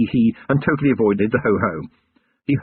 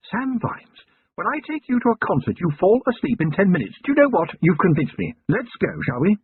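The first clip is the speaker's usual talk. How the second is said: higher, refined lady's voice, curious; Lady Sybil voice; 9 semitones higher than usual. A narrator's reading. Wife questioning her husband about the goblin girl, higher refined lady voice. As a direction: play the poised lady of the house asking.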